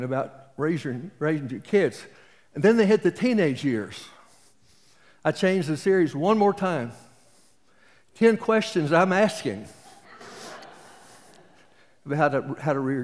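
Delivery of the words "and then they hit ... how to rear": higher and louder, slowing on the last words; exasperated punchline. Real, not acted. Preacher telling the congregation about teenage kids, comic exasperation. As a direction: land the joke with mock despair.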